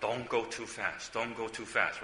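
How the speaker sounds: soft, slow, repeated caution; gentle warning. Retold or imitated. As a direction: softly warn him to slow down. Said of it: "So I always warn him".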